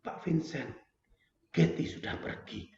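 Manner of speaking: breaking bad news softly through tears; retold crying news. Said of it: "Lani nangis-nangis".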